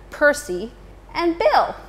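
usual speaking voice

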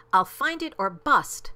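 fiercely resolute exclamation; determined cry. A narrator's reading. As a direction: cry out, hitting the last word hard. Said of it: "cried Horton".

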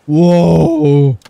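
amazed. Real, not acted. Amazed at launch.

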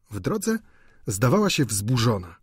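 plain reading voice

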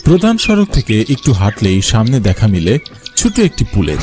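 usual speaking voice

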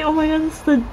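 high burst of fan delight; excited squeal. Real, not acted. Fan seeing her favourite member's card, high squeal. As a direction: shoot up in glee at the favourite card.

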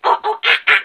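short bursts of monkey calls; monkey impression. Shown as a demonstration. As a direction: hoot and screech like a monkey. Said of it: "something like that?".

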